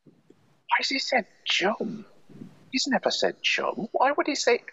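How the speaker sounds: high, slow baffled "Why has he said chum?"; hammed-up astonished bystander; mock bafflement. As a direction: stretch out the puzzled question in a put-on voice. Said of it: "Everyone would be going".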